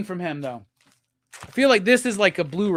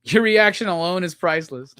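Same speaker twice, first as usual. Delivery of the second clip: ordinary talk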